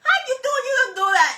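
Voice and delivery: high, loud imitation of Giovanni; mock shocked friend. Mimicking Giovanni at bingo, high and loud. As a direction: squeak it out like a shocked pal.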